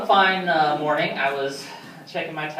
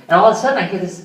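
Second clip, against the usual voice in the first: ordinary talk, nothing marked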